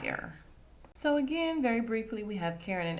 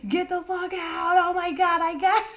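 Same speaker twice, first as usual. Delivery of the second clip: high, loud mock yell of laughing disbelief; imitating G yelling; 10 semitones higher, 7 dB louder than usual. G yelling from the background, high loud mock yell. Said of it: "you can hear G in the background like".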